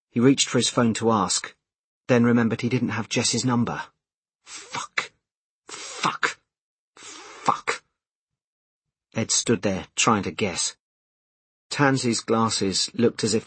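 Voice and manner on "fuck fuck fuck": soft, voiceless swearing; whispered cursing. A narrator's reading. Man realises he lacks her number, whispered cursing. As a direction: curse under your breath, word by word. Swearing under his breath.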